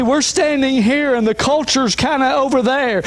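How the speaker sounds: ordinary talk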